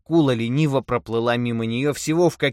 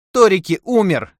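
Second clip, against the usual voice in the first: high, loud cry; shouting; 9 semitones higher, 10 dB louder than usual. A narrator's reading. Wife shouting the bad news at her husband, loud cry. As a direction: shout it at him angrily. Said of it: "крикнула она".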